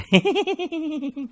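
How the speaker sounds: high squeaky giggle imitating the students; mocking girly giggle. Teacher mimics students' answer, high mocking giggle. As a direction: titter like the girls who laughed. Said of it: "girls के पास एक ही जवाब".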